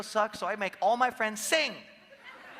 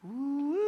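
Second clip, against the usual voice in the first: mock-mystical high ooh; a hammed-up psychic ooh, like a fortune teller; 7 semitones higher than usual. Shown as a demonstration. Coach showing the crowd the psychic sound, hammed-up. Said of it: "just do this".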